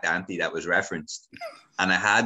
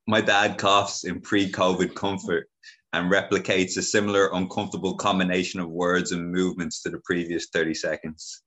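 ordinary talk, nothing marked

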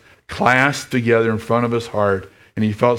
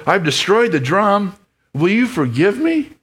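much higher, begging question; desperate plea; 10 semitones higher than usual. Acted. Lester pleading with Desborough, high and desperate. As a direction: beg forgiveness with all you have.

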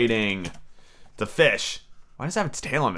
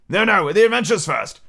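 raised, insistent interruption; impatient; 7 semitones higher, 5 dB louder than usual. A narrator's reading. Griffin interrupting the Mock Turtle, impatiently. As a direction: cut in with mild impatience. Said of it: "in an impatient tone".

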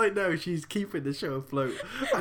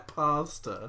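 pasta in a mock American accent, PAH-stuh; mimicked American accent. Teasing co-host over "bones are made out of pasta", mock American accent. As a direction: imitate an American saying pasta with an open ah. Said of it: "make fun of my accent".

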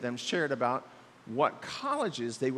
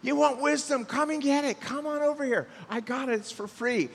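wisdom calling loudly down the street, higher; raised, high voice crying out an invitation; 8 semitones higher, 4 dB louder than usual. Acted. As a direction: call out to passers-by like a street crier. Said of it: "here's wisdom crying out".

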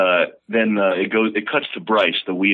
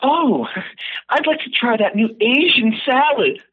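very high, prissy woman's voice; comic falsetto of a clueless diner; snooty woman. Acted. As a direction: play the stupid woman at dinner, way up high. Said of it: "and she goes".

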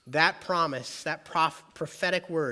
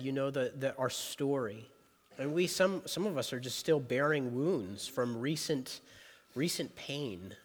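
prayer. Acted. Leads a prayer.

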